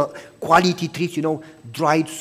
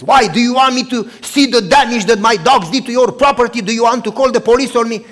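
loud, higher, suspicious challenge; suspicious neighbor; 6 semitones higher, 9 dB louder than usual. Acted. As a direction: fire back at him, wary and defensive. Neighbor's suspicious retort, acted.

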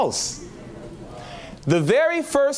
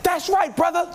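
excited cheer; 13 semitones higher than usual. Retold or imitated. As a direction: egg the preacher on from the pews. Cheering the pig sermon.

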